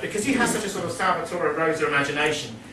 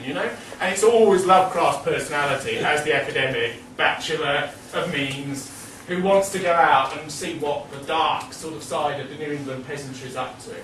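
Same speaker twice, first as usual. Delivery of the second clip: normal talking voice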